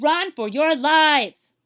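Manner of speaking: slow, loud, high urging; urgent warning. Acted. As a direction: stretch it out, urging them to flee. Boars warn the deer.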